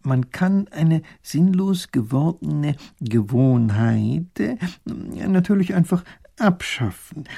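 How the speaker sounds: very slow musing voice; slow and thoughtful. Acted. Professor muses slowly to the duke, weighing each word. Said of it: "langsam und überlegend".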